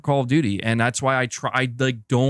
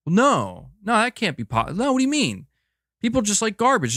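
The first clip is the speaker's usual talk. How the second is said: higher-pitched imitation of naysayers; mock indignant denial; 7 semitones higher than usual. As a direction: protest like an offended hater, voice jumping up. Mimics people denying the game's success.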